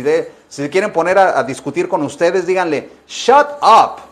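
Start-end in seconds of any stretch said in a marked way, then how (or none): 3.1-4.0 fuerte y agudo, cortante; suéltalo con fuerza; shut up gritado. Shown as a demonstration. Profe enseña a decir shut up. (grito de "shut up")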